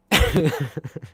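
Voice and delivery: short high laugh. Real, not acted. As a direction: let out a quick burst of laughter. Laughs at own chicken coop line.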